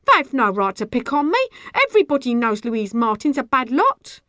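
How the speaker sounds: indignant plea to the crowd; appealing. A narrator's reading. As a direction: call on bystanders to back you. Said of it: "She appealed to the bystanders".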